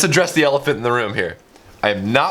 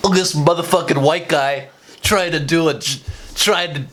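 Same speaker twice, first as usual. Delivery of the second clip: mock critic. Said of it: "people to call me out".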